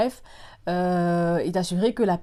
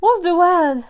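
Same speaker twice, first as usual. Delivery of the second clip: much higher and louder, laughing at herself; real amused fluster; laughing word search; 10 semitones higher, 11 dB louder, slower than usual. Real, not acted. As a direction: burst out mid-sentence hunting the word.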